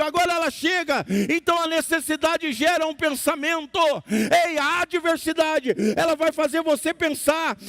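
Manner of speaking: high, shouted pulpit exhortation; full-force preaching. Real, not acted. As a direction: cry it out to the congregation, stretching the phrase ends. (pregação inflamada, aos brados e em tom agudo)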